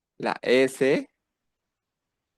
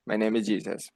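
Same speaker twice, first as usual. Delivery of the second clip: ordinary talk, nothing marked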